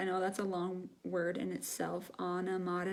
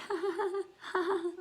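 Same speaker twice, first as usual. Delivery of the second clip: high little demo giggle; short, high-pitched titter; 11 semitones higher than usual. Shown as a demonstration. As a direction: giggle lightly in short bursts. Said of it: "like a little laugh".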